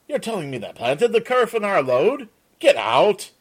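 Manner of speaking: shocked, can't believe it; incredulous. Acted. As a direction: Peter stunned by the news. Peter disbelieving the planting.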